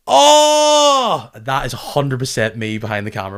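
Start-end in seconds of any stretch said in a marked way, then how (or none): 0.1-1.3 high, loud, thrilled "Oh!"; excited fan yell. Retold or imitated. Fan behind the camera at a goal, loud high yell. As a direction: let out a sudden delighted yelp. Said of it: "you'll just hear someone going".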